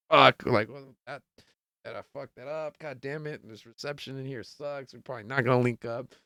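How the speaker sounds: very soft cursing to oneself; retold grumbling. Retold cursing after hanging up.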